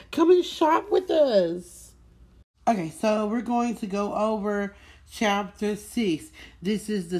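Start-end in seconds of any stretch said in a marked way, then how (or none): 0.1-1.9 high, slow, louder singsong call to shop; drawn-out cheerful sales pitch; sing-song shop invite. Acted. Host inviting listeners to her tea shop, slow bright singsong.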